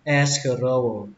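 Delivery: Escargot starting up on "es", then falling. Shown as a demonstration. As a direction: say "Escargot" slowly, first syllable lifted. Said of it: "Lên giọng âm đầu tiên".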